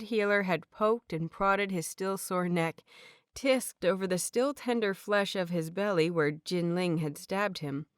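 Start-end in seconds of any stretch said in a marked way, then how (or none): none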